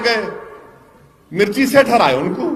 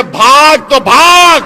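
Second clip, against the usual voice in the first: slow defiant taunt; 5 dB louder, slower than usual. Acted.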